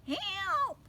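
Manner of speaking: high squeaky cry; squeak. Acted. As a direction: squeak out a plea. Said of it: "squeaked the butcher".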